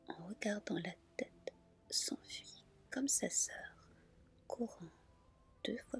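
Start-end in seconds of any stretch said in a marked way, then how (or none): none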